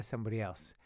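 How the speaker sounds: normal talking voice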